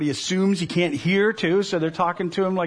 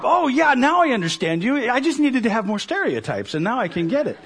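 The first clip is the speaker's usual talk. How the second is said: higher, louder sarcastic mock delight, laughing; mock tourist; 5 semitones higher, 4 dB louder than usual. Preacher mocks tourists on Amazing Race with sarcastic high glee. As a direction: send up the tourist who thinks an accent helps. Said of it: "they'll put on an accent".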